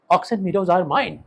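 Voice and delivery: proudly declaring ownership in excitement; excited exclamation. Acted. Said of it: "excited होके बोलता है". (उत्तेजित होकर ऊँची आवाज़ में धीरे-धीरे)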